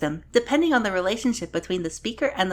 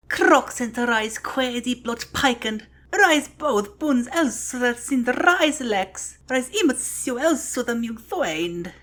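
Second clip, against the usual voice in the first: raised, emphatic declamation; dramatic recitation; 4 semitones higher, slower than usual. Acted. Finishing the Yodean sample text, dramatic declamation. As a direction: proclaim it with strong, drawn-out stresses.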